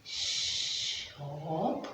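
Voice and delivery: soft unvoiced "Shh, up"; quiet robot segmenting; whispered robot. Shown as a demonstration. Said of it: "like Mike the robot".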